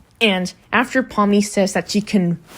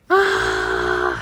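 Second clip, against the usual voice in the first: drawn-out mock falling wail; cartoon falling scream. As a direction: stretch out a restrained cartoon "aah". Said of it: "a cartoon style fall".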